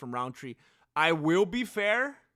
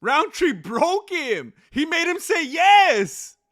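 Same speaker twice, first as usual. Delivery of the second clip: high, loud and slow with amazement; excited; 10 semitones higher, 10 dB louder, slower than usual. Real, not acted. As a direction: spell it out in disbelief. Amazed reaction to fight result.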